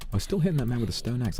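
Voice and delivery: usual speaking voice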